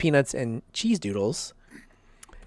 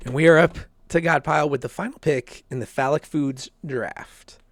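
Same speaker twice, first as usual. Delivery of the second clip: ordinary talk, nothing marked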